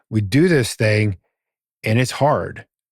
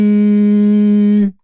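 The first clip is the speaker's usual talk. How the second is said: long loud chanted oh; 10 semitones higher, 7 dB louder than usual. Retold or imitated. The guru sits down and intones a long, loud oh. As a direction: hold a high, loud om-like oh. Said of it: "he's like".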